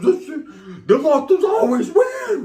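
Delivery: high, loud, drawn-out mock crying; wailing lament. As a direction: bawl it out in hammed-up despair. Acted despair in pain.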